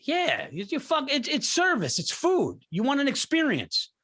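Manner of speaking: ordinary talk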